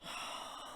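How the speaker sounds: weary unvoiced sigh; long sigh. A narrator's reading. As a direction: let out a tired sigh. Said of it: "Let out a sigh".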